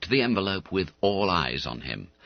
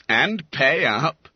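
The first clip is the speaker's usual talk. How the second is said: higher, louder, slow taunt; chortled; 6 semitones higher, 5 dB louder, slower than usual. Acted. Schoolmate demanding Bunter pay, chortling. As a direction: rub it in with relish. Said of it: "chortled Skinner".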